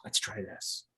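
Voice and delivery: very soft, whispered suggestion; Toby. Retold or imitated. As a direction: offer it under your breath. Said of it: "Toby was just like".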